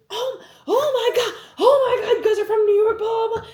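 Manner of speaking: loud, very high excited shrieking; mock hysterical screaming; imitated freak-out. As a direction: squeal like overexcited fans losing it. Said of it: "they lost their fucking shit".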